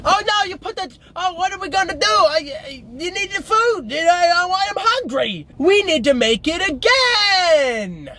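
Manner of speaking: hammed-up uncle with a weird accent; mocking Uncle John's strange video accent. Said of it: "comes in with this really weird accent".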